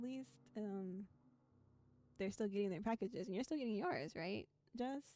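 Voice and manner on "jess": ordinary delivery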